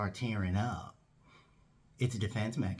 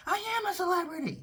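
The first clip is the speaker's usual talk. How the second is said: much higher, quick indignant retort; acted-out wounded pride, pitched way up; indignant outburst; faster than usual. Boss objecting on a retold phone call, indignant.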